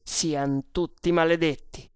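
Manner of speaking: usual speaking voice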